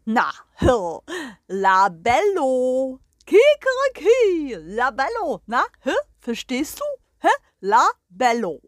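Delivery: crowing rooster voice, loud and pompous; proud rooster. Acted. The rooster greets the hens and the guest, proud crowing voice. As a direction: strut and crow like a proud rooster.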